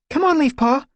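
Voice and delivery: cheerful call over the shoulder. A narrator's reading. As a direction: call back to your sister while running off. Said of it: "Squirrelpaw called over her shoulder".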